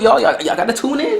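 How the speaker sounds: high, rising call to tune in; excited urging. Real, not acted. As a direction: pitch it way up and rally the viewers. Urging viewers, repeated twice.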